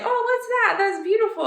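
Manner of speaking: high, gushing mock shopper voice; admiring shopper. Mother and daughter admiring the church, in a high gushing voice. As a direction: coo over it like a thrilled stranger.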